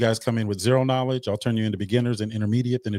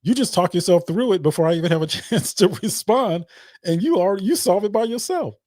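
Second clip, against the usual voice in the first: quoting a friend; 8 semitones higher than usual. Retold or imitated. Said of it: "He's like".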